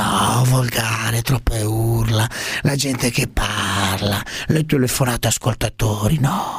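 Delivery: slow, low politician parody; Prodi. Acted. Parody statesman asked whether he listens to the show, slow low disapproval. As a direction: draw out each complaint in a low voice. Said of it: "scusi, Prodi".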